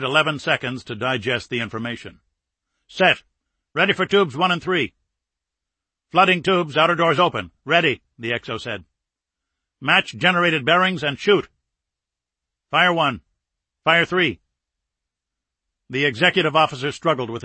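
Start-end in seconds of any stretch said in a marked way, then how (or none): 2.9-8.0 raised, tense readiness reports; brisk military callouts. Acted. Crew readies torpedo tubes for captain, brisk callouts. 9.8-14.4 raised, decisive orders; firing commands. Acted. Captain orders torpedoes fired at frigate, decisive commands.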